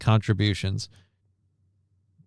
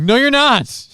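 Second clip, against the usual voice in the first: much higher, louder squeaky retort; childish retort; 13 semitones higher, 6 dB louder than usual. Retold or imitated. Agency sniping back, much higher and louder. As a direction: fire it back like a sulky kid.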